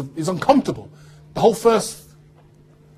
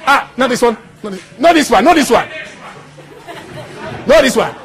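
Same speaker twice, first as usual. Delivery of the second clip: loud, high objection over playback; calling out; 7 semitones higher, 7 dB louder than usual. Real, not acted. Guest stops the wrong clip being played, calling out. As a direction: call it out high and loud, repeatedly.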